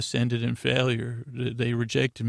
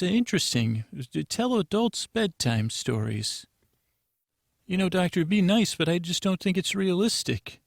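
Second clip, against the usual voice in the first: lightly voiced higher, hesitant official; institute receptionist; 5 semitones higher than usual. Institute staffer hesitantly answers the doctor's research call, lightly acted higher voice. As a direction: gently let the doctor down in a put-on higher voice.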